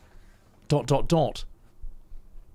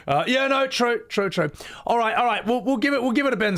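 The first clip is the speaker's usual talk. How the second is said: excited; 12 semitones higher than usual. Real, not acted. Laughing, high voice joking.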